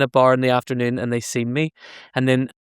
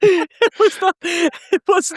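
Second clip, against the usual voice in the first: laughing; much slower than usual. Real, not acted. Laughing reaction.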